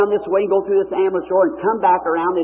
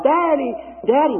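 high call, falling on each word; imitated hollering; 9 semitones higher than usual. Blind girl pushing through crowd, imitated hollering. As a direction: holler high, like a girl searching for her father.

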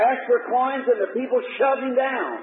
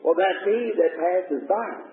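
ordinary delivery

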